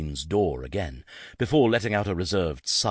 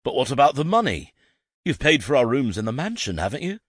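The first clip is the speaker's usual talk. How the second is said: worried protest; 8 semitones higher, 5 dB louder than usual. Acted. Blake protests, worried about money.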